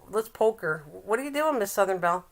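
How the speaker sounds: usual speaking voice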